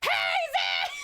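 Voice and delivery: put-on wake-up scream. Shown as a demonstration. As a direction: bellow her name to wake her. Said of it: "I could just scream".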